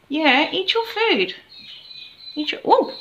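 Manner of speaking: high, slow sing-song coaxing to a pet; pet talk. Real, not acted. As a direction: coo at the bird to get it eating.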